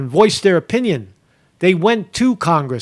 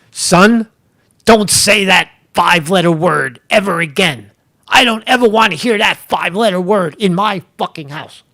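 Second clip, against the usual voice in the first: stern parent; 2 semitones higher, 4 dB louder than usual. Acted. A father scolding his son over saying Trump, loud. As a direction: bark it like an angry dad forbidding a word.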